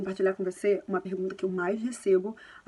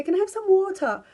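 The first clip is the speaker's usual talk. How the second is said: put-on child asking; 8 semitones higher, 5 dB louder than usual. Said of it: "Se ela falou com você".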